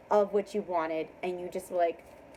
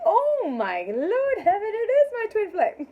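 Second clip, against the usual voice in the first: overjoyed exclamation; 11 semitones higher, 6 dB louder than usual. Acted. Woman realizing her twin flame is coming, elated. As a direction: cry it out high and loud.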